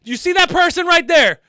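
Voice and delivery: high, loud insistent disbelief; incredulous. Real, not acted. Reactor spots a surfer behind the questioned sunbather, incredulous protest. Pointing out surfer, disputing police logic.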